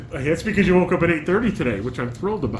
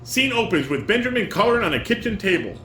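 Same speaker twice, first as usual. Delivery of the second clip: reading stage directions; 6 semitones higher, 3 dB louder than usual. Acted. Reads scene opening.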